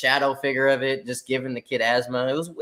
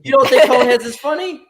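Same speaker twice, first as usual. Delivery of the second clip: laughing; 9 semitones higher, 5 dB louder than usual. Real, not acted. Laughing disbelief at friend.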